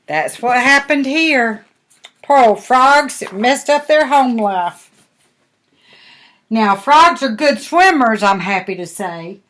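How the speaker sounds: ordinary delivery, nothing marked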